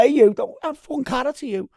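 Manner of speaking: high, loud mock indignation; imitating a teammate's playful outburst; joking telling-off. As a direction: pull rank as a joke. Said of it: "like, laughing".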